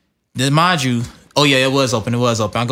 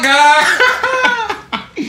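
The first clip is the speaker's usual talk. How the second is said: laughing squeal. Real, not acted.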